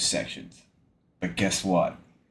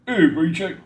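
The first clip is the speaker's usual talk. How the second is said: higher-pitched stab at a character voice; Morrow; 7 semitones higher than usual. Retold or imitated. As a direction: try out a half-remembered impression. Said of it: "No, wait, what's the Morrow voice?".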